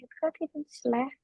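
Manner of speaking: fluent run. Shown as a demonstration. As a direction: say it quick and fluent as practised. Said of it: "dibaca-baca ulang nanti juga lancar lagi". (diucapkan lancar dan lebih cepat)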